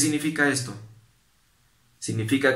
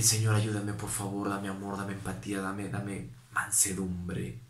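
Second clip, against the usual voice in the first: much softer, hushed plea to God; soft prayer; 3 semitones lower, 7 dB quieter than usual. Shown as a demonstration. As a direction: pray it quietly after a breath. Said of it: "Toma aire y pide".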